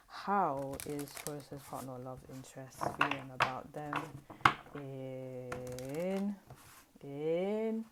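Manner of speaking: slow, drawn-out question to the cards; intoned card question. Real, not acted. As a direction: stretch the words out while concentrating on the shuffle. Intones the reading question while shuffling.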